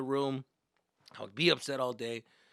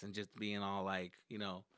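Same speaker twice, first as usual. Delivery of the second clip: ordinary talk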